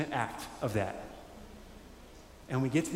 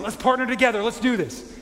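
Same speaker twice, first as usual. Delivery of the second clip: much higher and louder, rallying; hammed-up enthusiastic God calling partners; God's eager invitation; 9 semitones higher, 8 dB louder than usual. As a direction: cheer them on like a coach. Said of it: "of God saying".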